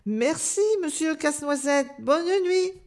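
high, polite thanks; lightly voiced hedgehog lady saying goodnight; mother hedgehog. A narrator's reading. Madame Hérisson's line in story. (maman hérisson, voix aiguë légèrement jouée)

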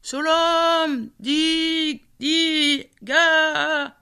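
the name broken into high, far-off echoing syllables; mountain echo. Acted. The mountains mocking Indiga, a high, far-off echo. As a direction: let the mountains throw back each syllable high and distant. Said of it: "les montagnes qui se moquaient de lui".

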